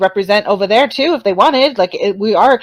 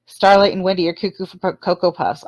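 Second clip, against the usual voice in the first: ordinary delivery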